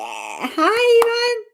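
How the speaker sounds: high, loud, delighted hello; excited greeting. Real, not acted. Greeting a friend who joined the first live stream, excited.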